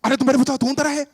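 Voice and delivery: loud, high, protesting husband; hammed-up loud film-husband pleading; husband in melodrama. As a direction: cry out in agitated Hindi denial. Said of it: "He's like".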